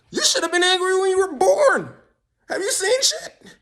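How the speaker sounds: very high, squeaky indignation; high-pitched mock outrage. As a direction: squeal it in hammed-up disbelief. Mock outrage at being born.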